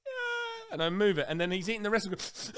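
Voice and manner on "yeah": put-on very high teary little boy yes; mock child whimper; weepy kid. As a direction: imitate a sniffling child. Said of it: "He's like".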